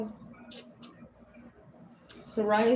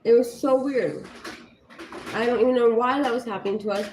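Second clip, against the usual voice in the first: ordinary talk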